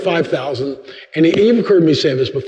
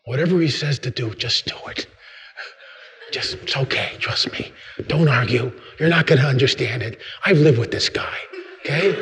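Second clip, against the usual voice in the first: much softer, partly whispered; hushed confiding; 3 semitones lower, 7 dB quieter than usual. Acted. Mary voiced, hushed confiding.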